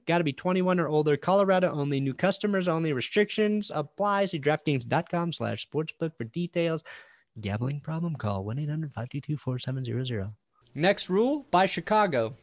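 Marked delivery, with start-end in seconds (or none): 0.0-10.3 rapid, flat disclaimer read; fast legal disclaimer. Acted. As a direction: rush through the fine print. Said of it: "ad legal disclaimer".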